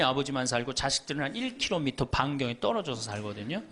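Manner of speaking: normal talking voice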